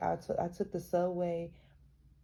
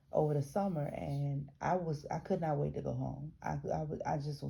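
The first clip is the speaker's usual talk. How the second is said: ordinary delivery, nothing marked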